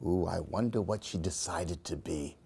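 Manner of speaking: ordinary delivery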